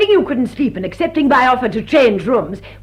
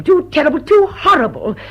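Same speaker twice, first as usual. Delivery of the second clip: louder and higher, appalled; distressed outcry; 4 semitones higher, 6 dB louder than usual. Acted. Mrs Ebley appalled at the theft, loud and rising. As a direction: build the horror, voice rising.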